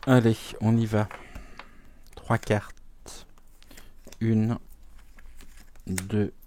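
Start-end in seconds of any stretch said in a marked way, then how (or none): 2.2-6.3 soft unvoiced whisper to herself; whispered aside while counting cards. Real, not acted. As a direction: breathe the words as you deal. Counts out cards under her breath.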